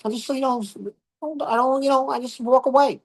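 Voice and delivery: high-pitched imitation of a sister; sister's voice. Brother imitating his sister's advice, in a high mock voice. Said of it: "She goes".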